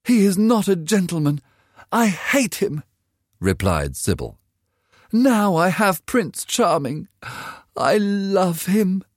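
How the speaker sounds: put-on a dreamy girl defending love over money; young girl Sybil. A narrator's reading.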